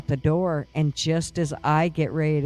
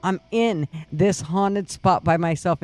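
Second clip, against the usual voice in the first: ordinary delivery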